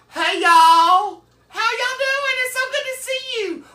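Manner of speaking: very high, sing-out gushing hello; flamboyant greeting. Acted. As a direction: greet the family in a soaring falsetto. Acts own greeting, opposite deep version.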